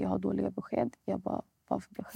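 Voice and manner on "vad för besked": hushed question. Retold or imitated. Asking brother about bad news.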